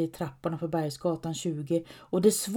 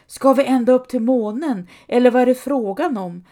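higher, louder shout of dismay; 4 semitones higher, 8 dB louder than usual. A narrator's reading. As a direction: yell up the endless stairs in protest. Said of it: "Ropar Sancho Panza". (ropar högt och förtvivlat)

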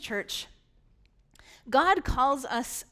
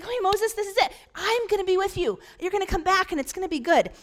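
higher and louder, cheerful pep talk; happy God; 7 semitones higher, 4 dB louder, faster than usual. Retold or imitated. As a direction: slap him on the shoulder with your voice. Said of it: "God's still kinda happy".

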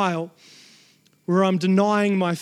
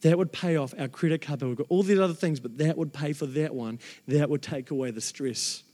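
hushed daydream; 6 semitones lower, 5 dB quieter than usual. Retold or imitated. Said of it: "I'd been daydreaming".

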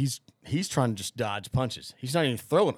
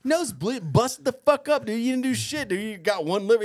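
excited retort; 9 semitones higher than usual. Retold or imitated. Retells arguing back at his buddy.

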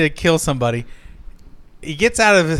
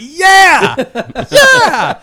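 much higher, louder excited yelling; cheering shout; 14 semitones higher, 9 dB louder than usual. Retold or imitated. Reliving watching the kill, cheering out loud. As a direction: cheer like a fan at a fight. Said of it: "And I'm like".

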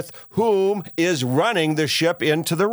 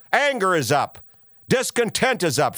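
ordinary delivery

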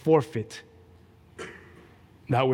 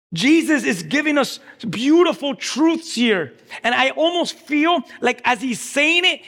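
forceful preaching; 9 semitones higher, 5 dB louder than usual. Real, not acted. Preacher urging the crowd to surrender, forceful preaching. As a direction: drive each phrase home to the congregation.

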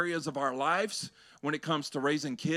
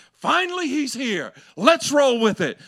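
excited crowd; 8 semitones higher, 9 dB louder than usual. Retold or imitated. Crowd voiced welcoming Jesus.

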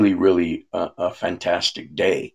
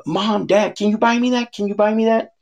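put-on high, begging little kid voice, much higher; pleading child; 14 semitones higher than usual. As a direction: beg like a four-year-old at Christmas. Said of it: "a four-year-old that says".

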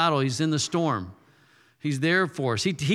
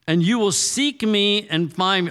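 lifted, louder, proclaiming the verse; emphatic scripture reading; 6 semitones higher, 4 dB louder than usual. Acted. Preacher to his church, proclaiming the verse louder. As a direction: read it out like a promise from God.

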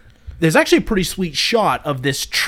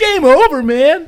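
much higher, louder panicked yell; Bill Paxton panic; 11 semitones higher, 7 dB louder than usual. Retold or imitated. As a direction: wail it like all hope is lost. Quoting Bill Paxton's Aliens line.

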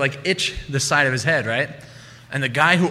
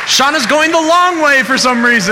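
much higher and louder hype; emcee announcement; 12 semitones higher, 3 dB louder than usual. Real, not acted. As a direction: pump up the crowd for her entrance. Host introduces Shauna to audience.

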